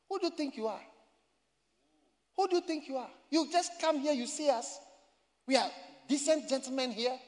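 high-pitched indignant tirade, acted out; imitated angry shouting. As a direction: mimic an offended man telling someone off. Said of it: "the man got angry. ... He shouted".

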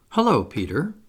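ordinary delivery

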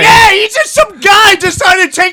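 bursting out in disbelief at the self-appointed guy; outraged shout. Real, not acted.

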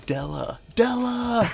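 building to a high drawn-out mock holler; imitated yell. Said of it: "Stu at one point is yelling".

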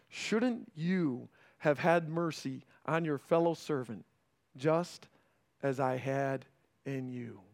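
scripture reading. Acted. Pastor reading the master's rebuke to his congregation, quiet and grave.

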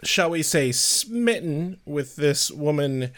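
ordinary talk

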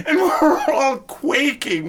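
slow, high, laughing loud; barely holding it together. Real, not acted. Band laughing on stage.

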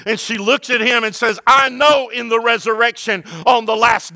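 loud, high, driving sermon cry; preaching at full force. Acted. Pastor preaching to his church, loud and high. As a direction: preach it hard to the congregation.

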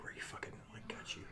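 hushed, voiceless muttering; retold whisper. Half-asleep man looking around for roaches, whispering. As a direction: whisper it under your breath, as if searching. Acted jumpy search for roaches.

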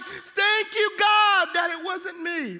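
mock prayer. Pastors praying over injured man.

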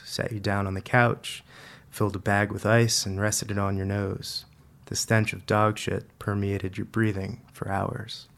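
ordinary delivery, nothing marked

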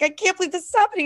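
retelling her own flustered panic, fast and high; panicked. Retold or imitated. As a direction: blurt it fast in a drunk panic. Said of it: "I was, like, panicked".

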